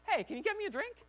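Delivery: high, light, casual ask; casual request. Retold or imitated. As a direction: playfully act out an easygoing request. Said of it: "just be like".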